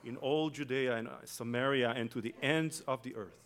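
loud, ringing scripture reading; preaching scripture. Real, not acted. Preacher proclaiming Acts to the church, loud and ringing. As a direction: proclaim the verse to the congregation. Reads scripture at full force.

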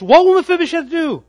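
"What will Mephibosheth do?" thrown out high and loud, hitting "will"; loud rhetorical challenge to the congregation; dramatic question. Shown as a demonstration. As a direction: belt the question to the back row. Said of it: "Dramatic pause in the text".

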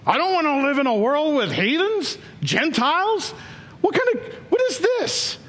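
high, sneering put-on voice of a disgusted prophet; mock Jonah's whiny contempt for outsiders; selfish Jonah. Pastor voicing Jonah scorning the Gentiles, high mocking whine. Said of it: "It's because he's selfish".

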